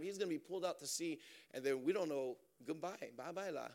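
ordinary delivery

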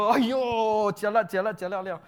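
high, loud Singlish wailing; hammed-up Aiyo lament; mock panic. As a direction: panic like people dying of snakebite. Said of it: "And then people".

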